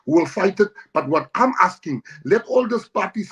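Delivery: rallying speech. Real, not acted. Rallying call to parties.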